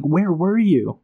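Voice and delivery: aggrieved king's outburst; ranting as Calor. Retold or imitated. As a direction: throw the blame back at them. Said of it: "And you're like".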